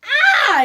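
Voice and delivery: sudden loud pained cry; loud startled shout; frustrated yell. Retold or imitated. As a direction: yelp as the ball smacks your face. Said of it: "You're like".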